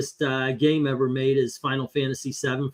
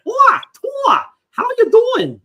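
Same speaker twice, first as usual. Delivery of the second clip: squeaky falsetto impression of the dubbed actor, loud; way up high and loud, cartoonish; mock high voice; 7 dB louder than usual. Mocking the Thai dub of Idris Elba, squeaky high. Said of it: "and he's like".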